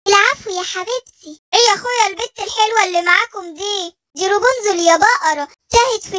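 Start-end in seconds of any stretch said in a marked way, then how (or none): none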